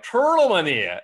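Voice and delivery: Beijing accent impression. Comedian mimicking a Beijinger's accent for a stand-up bit, hammed up. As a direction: ham up a thick Beijing accent. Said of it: "I'll do the impression of".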